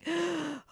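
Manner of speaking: sharp mock gasp of alarm; hammed-up horrified gasp. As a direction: gasp as if the book were hurt. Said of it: "I don't have to be all like".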